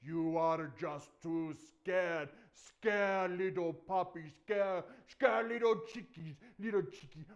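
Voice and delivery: big, slow, booming mockery; loud, drawn-out giant's taunt. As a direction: jeer loudly at cowards, stretching the words. Giant taunting the scared army.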